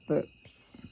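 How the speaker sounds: breathy mock burp sound. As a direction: let out a little burp. Burp sound effect in story.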